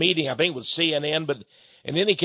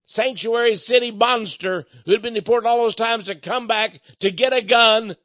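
ordinary talk

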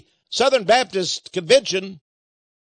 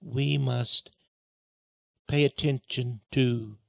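halting Pope reading; 8 semitones lower, 6 dB quieter, slower than usual. Retold or imitated. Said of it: "he's there breaking over it".

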